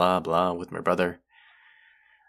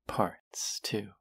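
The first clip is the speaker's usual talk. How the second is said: whisper; 2 semitones higher, 5 dB quieter than usual. Acted. Suggestive whisper.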